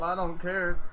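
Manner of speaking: ordinary talk, nothing marked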